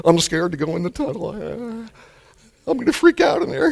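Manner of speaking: imitating a fearful patient, rising high; mock scared patient. Patient afraid of the MRI tunnel, mock fearful whine. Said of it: "people who are afraid to go in the".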